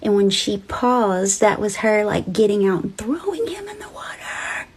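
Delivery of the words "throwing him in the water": hushed eerie whisper; spooky whisper. Acted. Storyteller whispering the creepy reveal to viewers. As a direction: whisper it like a secret.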